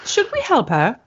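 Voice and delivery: lightly put-on English accent; polite, concerned English accent. Helper offering aid to a fainting visitor, English accent. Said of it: "I can't do accents".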